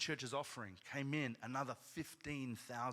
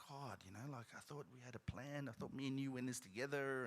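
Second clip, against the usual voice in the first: deflated; 7 dB quieter than usual. Retold or imitated. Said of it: "a little bit deflated".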